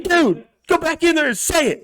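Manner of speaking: high put-on voice pushing him on; squeaky, insistent egging on; mock high urging. Bar guy urging him back inside, high mock voice.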